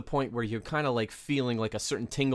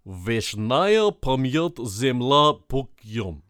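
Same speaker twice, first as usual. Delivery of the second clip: slow, loud Russian-accented reading; 7 dB louder, much slower than usual. Shown as a demonstration. Co-host attempting a Russian phrase on air, Russian accent. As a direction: read it out slowly in a Russian accent. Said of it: "I can do a good Russian accent".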